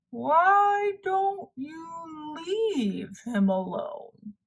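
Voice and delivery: very slow, drawn-out turtle voice. Acted. Turtle advises Toad on the way, very slow. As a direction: speak like an unhurried old turtle.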